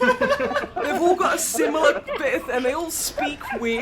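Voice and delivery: high, loud, laughing through the words. Real, not acted. Recalling a baffling British sketch to the stream, laughing hard. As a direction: giggle helplessly while explaining.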